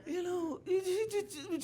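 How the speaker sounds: very high, stuck stammer; fumbling stammer. Retold or imitated. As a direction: get stuck on "it" in panic. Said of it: "mumbling and fumbling her way through".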